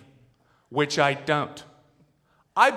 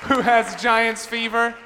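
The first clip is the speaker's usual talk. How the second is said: hype call; 8 semitones higher, 4 dB louder, slower than usual. Acted. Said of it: "Is everybody excited".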